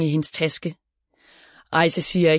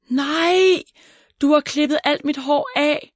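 high-pitched wail of dismay; upset cry; 9 semitones higher than usual. A narrator's reading. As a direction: cry out in horrified protest, drawing out the first word. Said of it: "råber hun, mens tårerne triller".

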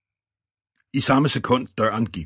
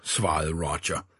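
plain reading voice

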